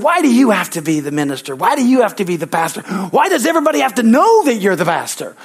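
high, loud indignant complaining; upset kid. Retold or imitated. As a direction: imitate an upset seventh grader protesting, pitch climbing. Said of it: "he's just so mad. He's upset".

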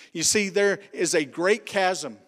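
usual speaking voice

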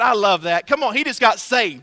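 ordinary delivery

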